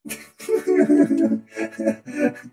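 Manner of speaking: loud high burst of laughing; long high cackle; real laughter. Real, not acted. Laughing during quack game.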